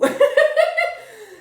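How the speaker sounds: loud, high laugh; high, real cackle of amusement; burst of laughter. Real, not acted. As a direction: burst out laughing at your own story. Amused by the retold story.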